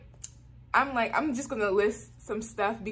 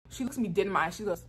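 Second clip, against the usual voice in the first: plain reading voice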